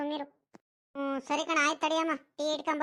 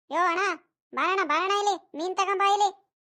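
loud, higher-pitched hail to a passing seller; calling out; 4 semitones higher, 5 dB louder than usual. Acted. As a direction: shout to bring the fish seller over. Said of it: "ಕೂಗ್ ಕರಿಯೋಣ ಅವ್ರಿಗೆ".

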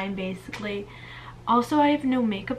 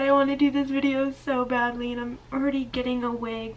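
usual speaking voice

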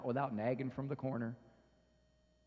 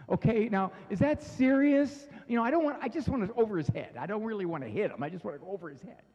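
frantic confession; 3 semitones higher, 4 dB louder than usual. Pretend. Parent confesses to the priest, hammed-up exasperation. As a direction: beg the priest for permission in mock desperation. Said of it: "bless me, Father".

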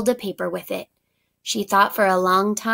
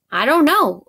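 higher and louder, anxious; worried answer; 5 semitones higher, 7 dB louder than usual. A narrator's reading. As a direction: Eunhae answers Ralph, worried and loud. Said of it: "Eunhae said".

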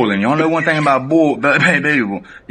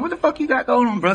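high, disbelieving question; can't believe what he's seeing; shocked outburst; 11 semitones higher, 3 dB quieter than usual. Retold or imitated. To the cellmate, high disbelieving question. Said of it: "I'm like".

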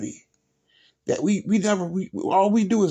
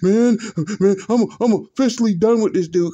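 mock upset fan; 2 semitones higher, 4 dB louder than usual. Vlogger imitating a fan quitting on LeBron, mock outrage. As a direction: ham up the fan's outrage. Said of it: "Old boy about to have a heart attack".

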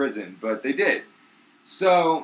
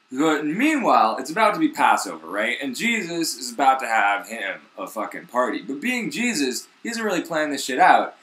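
ordinary talk, nothing marked

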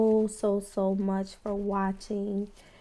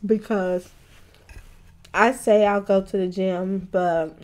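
eating between words; talking while chewing; 3 dB louder than usual. Real, not acted. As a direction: mouth partly full of food. Seen 2: Fork of yellow food held at mouth; chews, takes bites while talking.

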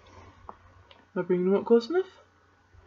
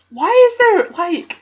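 high, loud, baffled delight; amused surprise; 10 semitones higher, 5 dB louder than usual. Real, not acted. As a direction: squeal at the odd find, laughing inside. Surprised by odd item.

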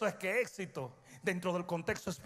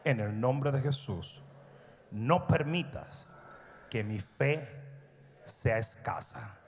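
guided prayer; 6 semitones lower than usual. Acted. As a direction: lead them gently in prayer. Leads congregation in prayer.

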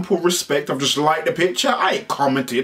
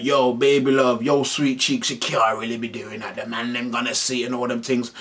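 mock soft tender plea; 6 semitones lower, 4 dB quieter than usual. Man telling his woman.

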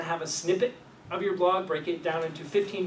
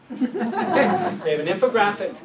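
laughing; 4 semitones higher, 9 dB louder, slower than usual. Real, not acted. Laughing at the zombie infographic.

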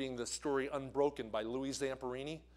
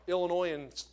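usual speaking voice

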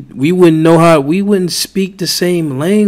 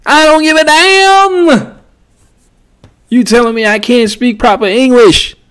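Chris Tucker voice; 7 semitones higher than usual. Pretend. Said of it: "In my Chris Tucker voice".

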